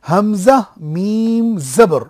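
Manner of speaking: হামজা মিম জবর spelled out very slowly; slow spelling demo. Shown as a demonstration. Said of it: "আপনারা আমার সঙ্গে পড়ুন".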